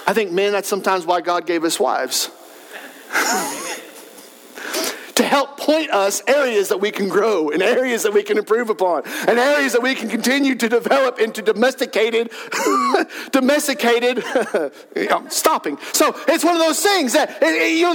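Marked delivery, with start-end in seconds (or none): 3.1-3.8 short, soft chuckle; amused laugh. Real, not acted. Preacher after a joke about wives, amused chuckle. 12.4-13.3 brief chuckle; amused laugh. Real, not acted. Preacher tickled by "domesticated", brief laugh.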